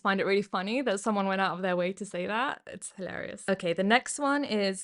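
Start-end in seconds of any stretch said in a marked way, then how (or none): none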